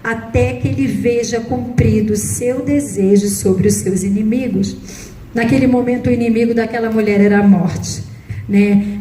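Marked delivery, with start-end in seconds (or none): 0.0-4.8 slow, solemn reading of the psalm; scripture reading. Acted. Preacher reading Psalm 112 to the congregation, slow and solemn. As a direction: read each verse deliberately, weighing it. Reads psalm verses aloud.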